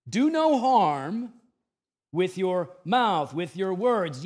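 slow, loud, high punch on "Do no harm"; preacher hammering home "Do no harm" slowly and loudly; preached emphasis. Acted. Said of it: "preached key line".

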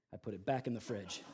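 low, soft deadpan delivery; understated punchline. Real, not acted. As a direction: drop the reveal quietly. First, understated take of punchline.

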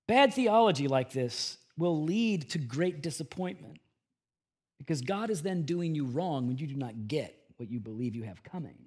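usual speaking voice

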